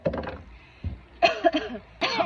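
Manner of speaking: loud coughing fit from spice; hard cough. Real, not acted. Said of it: "boğazıma gıcık yaptı".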